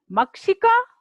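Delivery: पाठः called out high with the final breath of the visarga; Sanskrit recitation. Shown as a demonstration. Teacher models Sanskrit word for pupils, called out high. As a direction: model पाठः high and clear for the class to echo. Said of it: "जैसे जैसे उच्चारण किया है".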